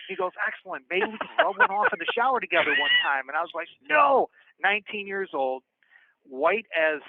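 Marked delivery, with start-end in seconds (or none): 0.5-3.3 lightly imitated scheming creep, pitched up; gleeful schemer. Inmate propositioning the young newcomer, mock gleeful scheming. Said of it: "He's rubbing his hands together". 3.9-4.3 high, loud retold "no" of alarm; emphatic refusal. Young inmate refusing a proposition, loud and appalled.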